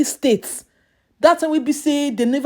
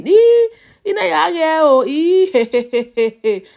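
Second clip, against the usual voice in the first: laughing; 5 semitones higher, 4 dB louder than usual. Real, not acted. Laughing while describing the man.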